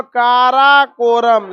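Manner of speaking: "Karakoram" slowly stretched, part by part; spaced-out syllables. Shown as a demonstration. Teacher shows map's spread lettering, "Karakoram" stretched out. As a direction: draw out "Karakoram" as if widely spaced on the map. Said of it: "अलग अलग अक्षर में लिखा हो".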